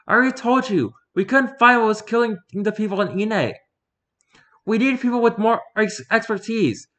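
confident woman hunter, voiced high; Fumiko's voice. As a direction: lift the pitch up for the huntress.

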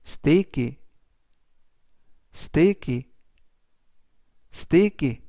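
"sticky" said clearly with a falling tune, each take after a pause; teacher modelling "sticky" for students to repeat; careful "sticky", stress on the first syllable; model pronunciation. Shown as a demonstration. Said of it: "라고 발음하시면 되겠고요".

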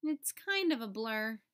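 ordinary delivery, nothing marked

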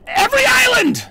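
yelled high and loud, voice strained; mock-outraged yell; shout. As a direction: bellow it at the top of the list. Shouted peak of rant.

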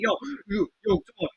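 ordinary talk, nothing marked

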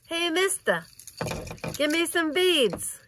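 high-pitched mock plea to a passing stranger; playful calling out. Playfully calls out for parade beads.

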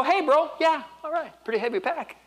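high, bright-spirited mock cheer; cheery bro voice. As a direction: play the cheerful pack carrier. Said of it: "and go, 'Hey, bro".

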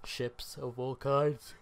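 slow words stretched by a yawn. Real, not acted. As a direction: talk through a big yawn. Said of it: "Sorry for the yawn".

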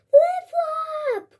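high, loud excited shout; joyful cry. A narrator's reading. Rahib spots his lost frog, shouting with joy. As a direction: cry out the frog's name in delight. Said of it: "cried Rahib".

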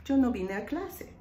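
normal talking voice

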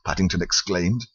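ordinary delivery, nothing marked

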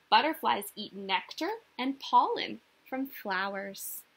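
ordinary talk, nothing marked

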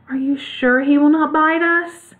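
high, timid little mouse voice. A narrator's reading. Mouse queen asks whether the dog bites, timid. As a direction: ask it timidly, in a small high voice. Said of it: "asked in a timid voice".

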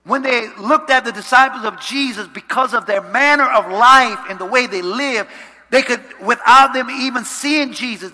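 very loud, high-pitched sermon surge; full-force preaching. Real, not acted. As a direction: drive the point home at full voice.

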